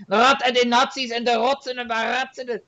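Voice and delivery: high, soft mock German rant; mock angry German. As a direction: imitate how German sounds to outsiders. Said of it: "when I hear German, it's always".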